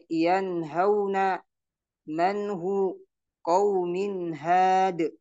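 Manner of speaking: yanhauna slow, clear nun; recited with izhar. Shown as a demonstration. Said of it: "dibaca izhar". (bacaan izhar perlahan)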